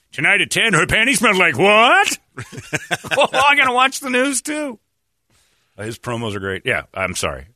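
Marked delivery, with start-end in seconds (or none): 0.1-2.2 high, loud teasing promo voice; mock anchorman rising to a squeal; news promo tease. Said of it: "Steve Irvin comes on". 3.2-4.8 mock excitement.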